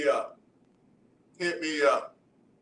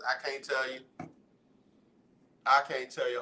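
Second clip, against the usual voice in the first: usual speaking voice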